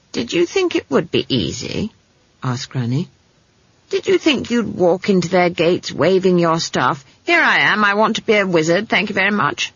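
tart, incredulous granny voice; scornful old witch. Acted. Granny mocks the girl's wizard plans, tartly. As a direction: build into mocking disbelief.

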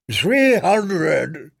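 wheezy fat man calling out. A narrator's reading. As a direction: wheeze out the bid. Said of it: "he called out in a wheezy voice".

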